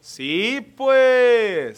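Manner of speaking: Sonoran demo, loud with stretched words; drawn-out Sonoran accent. Shown as a demonstration. Teaching a visitor to talk Sonoran, loud drawn-out accent. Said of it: "tienes que alargar todas las palabras".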